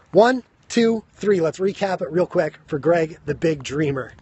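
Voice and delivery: ordinary talk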